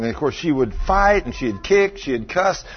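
normal talking voice

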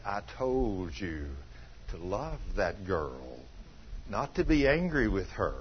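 low, slow, soft voice of God. Retold or imitated. God correcting the husband, lightly voiced slow and low. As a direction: speak as God, slow and grave. God speaks in retold story.

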